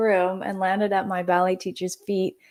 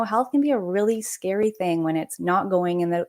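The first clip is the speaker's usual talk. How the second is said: ordinary delivery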